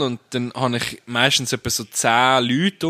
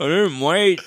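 mock Scottish accent; 6 semitones higher than usual. Mimicking Scottish tourists' accent.

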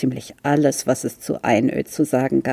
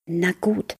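whispered; 7 semitones higher than usual. A narrator's reading. Fritz whispers to the turtle so his sister won't wake. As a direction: lean in and whisper so no one hears. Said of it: "flüsterte er".

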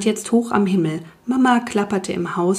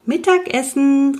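high-pitched mum calling everyone in; raised, singing-out dinner call; 7 semitones higher, 3 dB louder than usual. A narrator's reading. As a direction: call through the window to those outside. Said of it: "rief Mama durchs offene Küchenfenster".

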